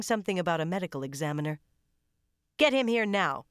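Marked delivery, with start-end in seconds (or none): 2.6-3.5 loud, higher barked command; barked order. A narrator's reading. McGovern barking orders at her team, loud and sharp. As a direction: bark it at the crew. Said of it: "she barked".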